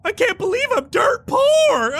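high, slow sobbing wail; crying laugh. Acted. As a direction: cry the words, then laugh-sob. NPC sob-laughs his pun.

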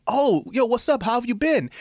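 high, bright-eyed hello; teen greeting his old friend's little brother; overly cheerful greeting. Retold or imitated. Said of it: "I'm like".

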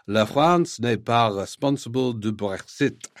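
French read aloud in an English speaker's accent; terrible French accent. Real, not acted. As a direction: have an earnest go at a French accent. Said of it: "that terrible French accent".

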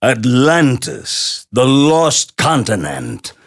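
slow, booming announcer voice; Paul Frees big voice. Retold or imitated. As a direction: intone it like an old movie narrator. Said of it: "he would go".

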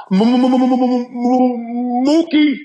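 a hammed-up high stutter, rising into the name; mock stutter. Podcaster mocking a listener's stutter, hammed-up and high. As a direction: stammer hard before spitting out the name.

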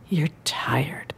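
soft, whispered and drained; hushed, tired aside; weary whisper. Acted. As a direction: whisper it as if worn out. Setting a weary story scene.

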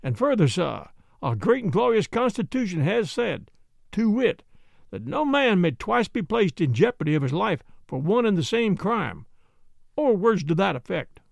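lightly voiced German-accented Jan, lecturing; a Dutch-German immigrant lecturing the men in a German accent.

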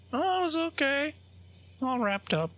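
put-on high, crinkly old-man impersonation. The doll answers about his flight, crinkly old man. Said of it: "like that".

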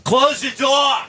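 high-pitched Boston dad bellow; Boston father yelling. Acted. Father yelling at his son around the house, mock Boston holler. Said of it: "Boston father and son".

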